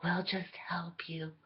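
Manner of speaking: soft, sugary, sinking to a whisper; mock fairy godmother. Fairy godmother soothing Cinderella, mock sweet whisper. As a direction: coo it like a sly godmother. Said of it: "come in here and be like".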